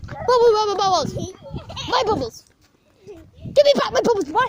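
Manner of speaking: put-on high whining child begging for bubbles; whiny kid voice. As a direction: pitch up and whine like a bratty little kid.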